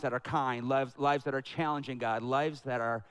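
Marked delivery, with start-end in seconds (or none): none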